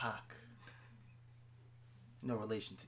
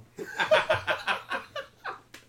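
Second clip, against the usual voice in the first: high, loud burst of laughter; helpless high cackling; loud laughing fit; 10 dB louder than usual. Real, not acted. Breaks up at co-host's remark.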